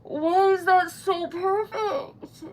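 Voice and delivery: high, slow, close to real tears; tearful disbelief. Real, not acted. Stunned by the perfect wax seal, tearful and slow. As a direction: near tears over a perfect result. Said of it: "I'm gonna cry".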